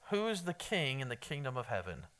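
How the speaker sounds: asked softly, low and unforced; quiet question. Real, not acted. Preacher quietly quizzing the congregation, soft. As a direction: toss the question out gently to the crowd. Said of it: "Oh, I thought it was a softball".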